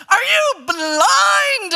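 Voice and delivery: high, loud, slow indignant yell; yelling at the refs. Retold or imitated. Mom yelling at the referee, angry shout. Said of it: "I would really yell at those refs".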